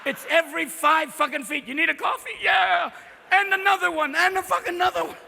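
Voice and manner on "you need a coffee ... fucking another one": manic coffee shouting. Acted. Pushing coffee on him.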